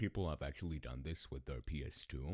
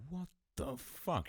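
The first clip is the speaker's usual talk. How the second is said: retold dad's yell; 13 semitones higher than usual. Dad finding sand in his gas tank, mock yelling. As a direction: imitate an outraged father at a higher pitch. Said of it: "He started yelling".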